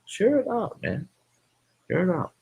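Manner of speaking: high, hammed-up urging voice; mock reminder. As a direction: cheerfully nag them to share. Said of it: "I'm gonna be reminding people".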